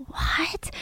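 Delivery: hushed whispered aside. Real, not acted. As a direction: whisper it like a secret. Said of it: "whispered aside".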